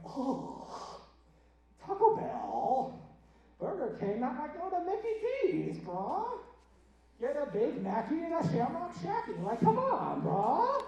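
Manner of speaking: high, affected bro voice; exaggerated, drawn-out mock disdain; mocking fast-food snob. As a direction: sneer at the choice like a dismissive bro. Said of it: "people hear that and you go".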